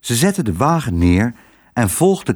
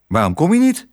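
high, testy question; irritable, impatient crow; peevish; 6 semitones higher, faster than usual. A narrator's reading. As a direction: ask it crossly, pitched up. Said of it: "vroeg hij kribbig".

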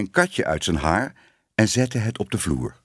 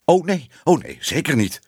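high, louder panicky denial; flustered backing down; 8 semitones higher, 3 dB louder than usual. A narrator's reading. Editor placating the shouting Ellemeet, alarmed. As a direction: take it back hastily, voice jumping up.

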